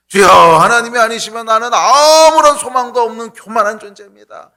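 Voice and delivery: 세리의 절박한 기도, 높고 크게; 높고 큰 목소리로 간절히 부르짖듯; tax collector's prayer. Acted. (세리가 하나님께 절박하게 부르짖는 기도)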